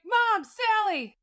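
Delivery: high, drawn-out call for help; retold calling out. Sleeper calling out for help to a roommate, retold yell. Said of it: "And I yell out".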